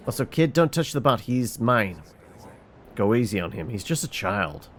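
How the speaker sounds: ordinary talk, nothing marked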